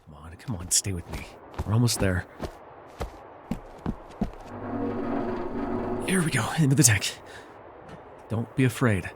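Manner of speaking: soothing. Acted. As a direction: comfort him into calm. Soothing the injured trainee.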